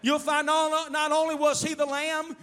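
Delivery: loud, driving declarations; full-force preaching. Real, not acted. Preacher telling the congregation who He is, impassioned loud preaching. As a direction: proclaim it at the top of your voice.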